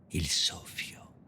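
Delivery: slow, breath-like whisper. Acted. Poet turning the word for breath into wind, slow whisper. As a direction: let the words fade into pure breath.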